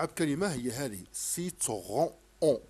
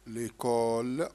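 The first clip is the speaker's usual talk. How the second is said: ليكول stretched slowly; slow word; 4 semitones lower, much slower than usual. Shown as a demonstration. Teacher slowly modelling a word to a pupil. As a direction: draw ليكول out slowly for the child. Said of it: "كاين هنايا".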